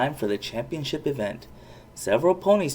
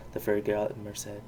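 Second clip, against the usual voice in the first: plain reading voice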